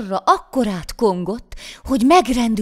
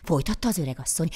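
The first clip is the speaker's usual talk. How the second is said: usual speaking voice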